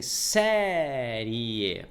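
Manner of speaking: "série" with a long, strong first E; stress on the E. Shown as a demonstration. Teacher showing which vowel is stronger, drawn-out stressed "série". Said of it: "mais entonação na letra E".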